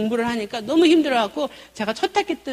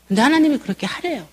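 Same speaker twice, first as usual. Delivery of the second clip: ordinary talk, nothing marked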